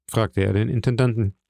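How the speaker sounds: ordinary delivery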